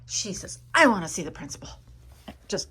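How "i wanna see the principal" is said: loud, firm demand, much louder; angry mother demanding the principal, much louder. Retold or imitated. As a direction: mom storming in, insisting loudly. Angry mom demands principal, retold.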